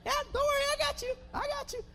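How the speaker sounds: high-pitched, hammed-up indignation; mock mad king. Mad king strips before the man asking help, high mock anger. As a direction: taunt in a high, worked-up mock voice. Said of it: "the king started getting mad".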